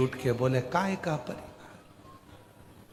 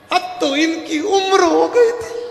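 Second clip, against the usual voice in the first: very high, slow, loud mock-weeping; weeping wife; 9 dB louder, slower than usual. Wife wails excuses over her husband's body. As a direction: wail it out, hammed-up. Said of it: "पत्नी रोते रोते बोली".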